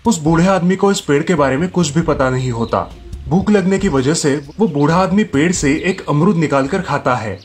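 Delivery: ordinary delivery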